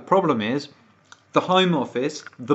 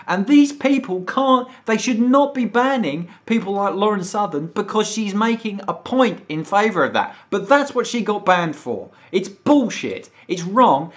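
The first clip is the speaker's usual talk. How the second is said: heated rant; 7 semitones higher than usual. Real, not acted. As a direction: push it up in pitch and volume, furious at the ban. Ranting about the ban.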